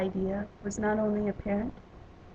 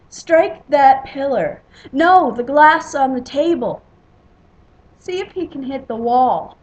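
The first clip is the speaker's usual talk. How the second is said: loud, higher shouts from a crowd; calling out; 4 semitones higher, 12 dB louder than usual. A narrator's reading. Betting crowd names targets for the spear, calling out. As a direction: shout suggestions over the hall.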